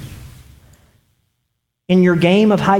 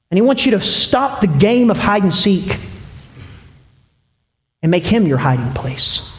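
ordinary delivery

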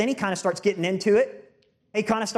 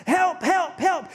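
retold scream for help; 8 semitones higher, slower than usual. Man in gorilla suit facing a lion, screaming for help. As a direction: yell help like a man in panic. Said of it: "he starts to scream out".

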